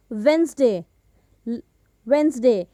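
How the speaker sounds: वेडनेसडे as WENZ-day, silent first D; low, separate वेडनेसडे said as "wensday"; correct pronunciation. Shown as a demonstration. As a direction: drop the first D in वेडनेसडे, two syllables. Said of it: "इसका जो सही उच्चारण है".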